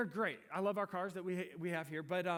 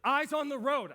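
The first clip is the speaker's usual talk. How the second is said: put-on kids yelling; 9 semitones higher, 10 dB louder than usual. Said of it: "my kids kept yelling at me".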